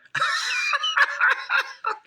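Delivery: a high laugh that bursts into a shout. Real, not acted. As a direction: let the laugh explode up high. Laughing at his own story.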